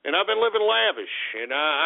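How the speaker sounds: usual speaking voice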